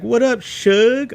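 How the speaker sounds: high, drawn-out greeting; imitated hailing. Guy on the basketball court greets a lookalike, imitated hailing. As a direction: mimic a guy calling out to a pal.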